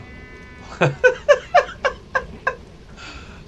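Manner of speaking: high, breathy burst of laughter; can't hold back the laugh. Real, not acted. Laughs at a silly note.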